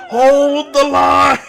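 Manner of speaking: high, loud, slow shout of hold the line; loud mock. Real, not acted. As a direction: belt it like a rally cry. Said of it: "he's speaking so loud".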